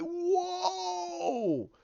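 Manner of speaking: high, far-off yelp of amazement; amazed cry. Retold or imitated. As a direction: let out a sudden high whoa. Audience reacting to a death.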